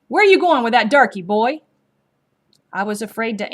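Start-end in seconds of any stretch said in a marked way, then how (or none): 0.1-1.6 loud, fast, higher taunting yell; mocking yell. A narrator's reading. Soldier yelling at the boy on the road, loud fast taunt. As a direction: hurl it at the boy as a threat. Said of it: "one yelled at me".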